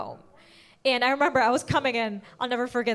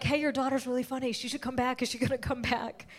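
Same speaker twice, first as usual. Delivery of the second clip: MC voice; 9 dB quieter than usual. Retold or imitated. MC voiced, quieter and quick.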